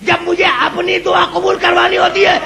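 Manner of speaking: high, ringing declamation, stretched out; majestic wrath. Retold or imitated. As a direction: build a lofty rebuke to a climax. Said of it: "رحمت نے جلال کے عالم میں کہا". (جلال بھرا بلند لہجہ)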